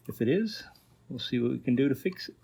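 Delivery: normal talking voice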